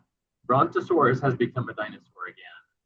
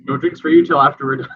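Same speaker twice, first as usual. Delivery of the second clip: mock stern order; 4 semitones higher, 10 dB louder than usual. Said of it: "you're like".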